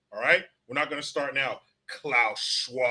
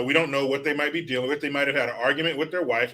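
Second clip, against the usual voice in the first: ordinary talk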